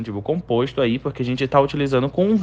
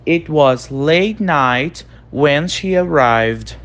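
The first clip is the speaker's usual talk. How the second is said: slow model reading; much slower than usual. Shown as a demonstration. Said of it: "Então, era de madrugada quando ela chegou".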